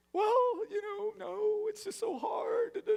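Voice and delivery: high, slow reluctant excuse voice; hammed-up high whine; mock whiny excuse. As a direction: squeak out a guilty excuse. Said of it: "Did you do what I told you?".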